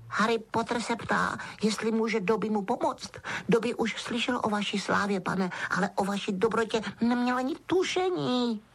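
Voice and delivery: high, adoring elf voice; Dobby, awestruck house-elf. A narrator's reading. Elf amazed at Harry's goodness, awestruck. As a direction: marvel at him in a squeaky voice.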